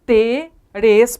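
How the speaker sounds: "interes" in separate syllables in-te-res, the r starting the last; spoken syllable split. Shown as a demonstration. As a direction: break "interes" slowly into in, te, res. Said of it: "porque é assim que nós falamos".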